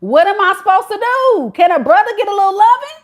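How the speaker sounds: high, loud pleading; voicing the man. Reader voicing the boyfriend begging Shay for love, high and loud. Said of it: "And he's like".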